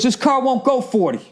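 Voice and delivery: imitating the Jaguar driver's prissy retort; mocking the driver. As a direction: sneer it like a smug rich man. Retold quarrel, other driver's reply.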